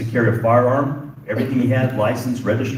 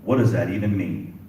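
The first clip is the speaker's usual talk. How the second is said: normal talking voice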